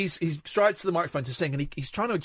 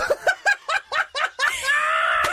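shrieking burst of laughter; real high-pitched guffaw; loud high laugh; 3 dB louder than usual. Real, not acted.

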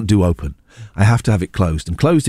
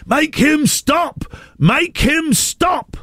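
high, drawn-out imitated plea; frantic earpiece producer; much slower than usual. Producer to presenter as [name] swears live, mock-frantic. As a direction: mimic a producer wailing down the earpiece. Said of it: "I was getting in my ear".

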